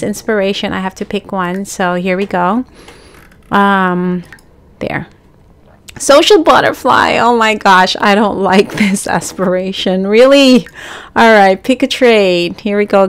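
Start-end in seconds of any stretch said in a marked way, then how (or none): none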